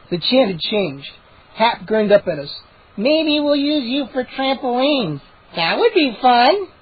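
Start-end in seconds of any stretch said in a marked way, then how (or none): 3.0-6.7 slow, higher gnome voice; grinning gnome, lightly voiced. A narrator's reading. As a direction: tease them slyly. Hap grinning.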